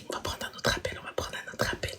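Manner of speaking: breathy whisper, no voice. Real, not acted. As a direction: whisper it to yourself. Whispered aside, then said aloud.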